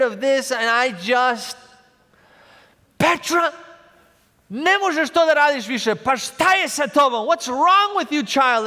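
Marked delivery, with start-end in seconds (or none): 3.0-8.7 a mild mock scold, louder and higher; angry parent. Father retelling how he scolded his toddler, mild mock anger. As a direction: replay scolding the child. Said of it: "And I got angry".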